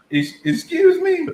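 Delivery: high, slow, disbelieving; mock shocked officer; incredulous. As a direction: stretch the disbelief.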